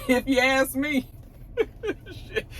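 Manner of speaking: high, laughing through the words; amused, giggly and high. Real, not acted. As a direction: chuckle as you say it. Chuckling over a spicy party memory.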